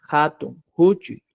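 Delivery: "Jato, Juchuy" slowly, with a Spanish J; H como jota. Shown as a demonstration. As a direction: scrape the first sound of "Jato, Juchuy" like a Spanish J. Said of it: "Como la J". ("Jato, Juchuy" con jota, despacio)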